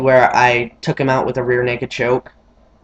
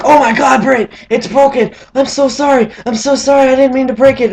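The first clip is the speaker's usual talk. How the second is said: much higher, louder frantic "I'm so sorry"; panicked apology; 10 semitones higher, 4 dB louder than usual. Retold or imitated. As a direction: panic over the broken arm, pitched high. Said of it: "Ringo was like".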